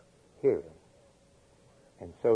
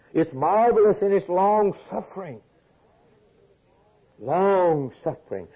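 much higher, louder, full preaching fervor; fervent exclaiming; 8 semitones higher, 4 dB louder than usual. Real, not acted. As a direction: lift the words in wonder.

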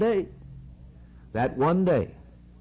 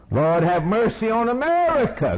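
mock prayer; 10 semitones higher than usual. Mocking a generic prayer.

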